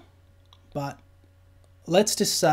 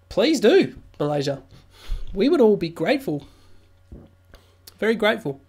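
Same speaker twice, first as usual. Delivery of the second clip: excited plea; 6 semitones higher, 5 dB louder than usual. Acted. Pleading playfully with Malaysia.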